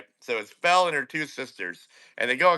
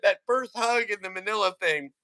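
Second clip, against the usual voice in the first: ordinary delivery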